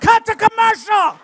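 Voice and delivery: high loud slow announcer bark; TV producer shout. Retold or imitated. TV crew cutting away from the scene, high slow shout. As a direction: cry it out like a panicked producer. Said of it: "They'd have been like".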